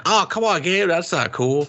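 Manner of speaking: high, whiny complaint; lightly voiced whining squire; mock whine. Friend mocking the friend-zoned squire, high and whining.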